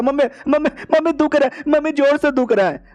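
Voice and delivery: a boy pretending to hurt, calling for mom; fake pain whining. Son fakes a tummy ache to fool parents, whiny. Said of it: "बेटा acting करने लग जाता".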